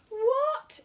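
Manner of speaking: outraged bear; hot exclaim. A narrator's reading. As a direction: Paddington hotly protesting to the host. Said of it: "exclaimed Paddington hotly".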